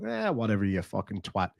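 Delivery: low, offhand brush-off; dismissive. Acted. Telling listeners how to answer a bigot, dismissive. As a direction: mutter it off with a shrug.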